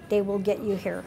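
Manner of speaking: ordinary talk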